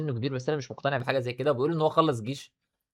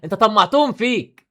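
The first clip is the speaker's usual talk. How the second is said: angry scolding; 8 semitones higher, 10 dB louder than usual. Acted. Scolding a friend who brought it on himself, loud and angry. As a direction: scold him like he pushed his luck. Opening skit, scolding someone.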